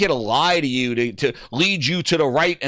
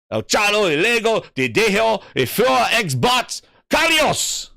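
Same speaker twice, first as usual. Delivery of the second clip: higher, emphatic stab at Spanish, last word peaking; amateur Spanish; 5 semitones higher than usual. Real, not acted. Streamer showing Tio his Spanish, proudly amateur Spanish. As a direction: belt out your homemade Spanish with confidence. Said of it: "That's my Spanish".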